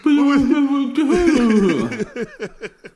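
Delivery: a big real laugh that breaks out loud; loud burst of laughter. Real, not acted.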